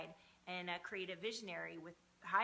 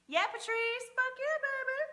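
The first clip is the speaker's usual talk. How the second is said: much higher, loud whoop; excited cheer; 10 dB louder than usual. Real, not acted. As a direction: whoop for a viewer, high and loud. Cheering a viewer in chat.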